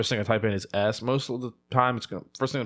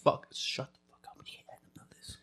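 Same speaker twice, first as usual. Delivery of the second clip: urgent hushed whisper; 13 dB quieter than usual. Pretend. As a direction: hiss it so nobody hears. Hiding secret from viewers jokingly.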